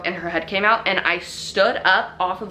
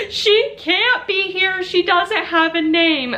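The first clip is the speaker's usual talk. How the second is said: put-on high, slow, louder panicked child voice; freaking out kid; 13 semitones higher, 5 dB louder, slower than usual. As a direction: imitate a little girl wailing in alarm. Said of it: "she's freaking out".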